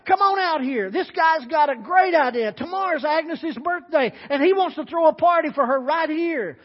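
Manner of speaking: loud, high call toward the kitchen; shouting to wife. Acted. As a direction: holler back through the swinging door. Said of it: "he shouts to his wife".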